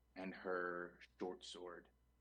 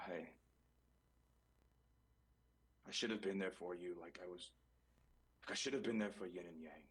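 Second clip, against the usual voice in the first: halting, understated sorrow; grieving apology. Acted. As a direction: falter through a regretful farewell. Zen mourns over captain's body.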